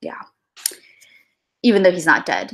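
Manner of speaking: normal talking voice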